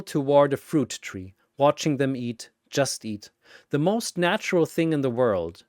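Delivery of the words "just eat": ordinary delivery, nothing marked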